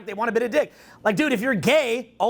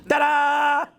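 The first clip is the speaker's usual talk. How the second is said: showman ta-da; 4 semitones higher than usual. Pretend. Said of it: "My new hit".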